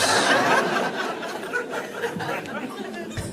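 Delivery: long high airy laugh rising. Real, not acted. Host laughing at the guard bit, long airy rising laugh. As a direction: let a long wheezy laugh climb.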